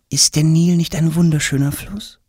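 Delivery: high, matter-of-fact lady's voice; as if everyone knew that; surprised court lady. Acted. Lady explaining the queen's milk bath to Tom. Woman speaks.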